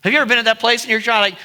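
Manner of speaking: usual speaking voice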